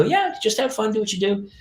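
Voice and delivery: high, light, casual reassurance; downplaying. Mother easing her child before first rehearsal, high-voiced downplaying. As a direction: play it down in a lifted, breezy parent voice. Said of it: "I try to downplay it".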